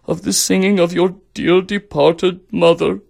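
mock tearful, rising toward a sob; starting to cry. A narrator's reading. Emperor recalls his late wife, mock tearful. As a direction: begin to blubber as the emperor. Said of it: "The emperor started to cry".

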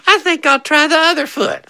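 put-on very high, squeaky little-kid voice; put-on tiny boy's voice; little brother voice. Quoting the little brother gamely retrying.

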